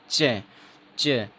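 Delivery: slow clear "च" with air held then let out; pron demo. Shown as a demonstration. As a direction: say "च", closing fully before release. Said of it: "आप बोल के देखिए".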